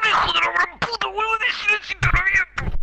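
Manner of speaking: high, fast screaming rage; furious shout. Pretend. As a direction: scream it out at your friend, cracking. Mock rage at teammate in game.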